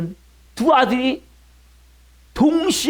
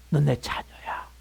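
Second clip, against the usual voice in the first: hushed appeal; 3 semitones higher than usual. Acted. Preacher voices God to His child, hushed appeal.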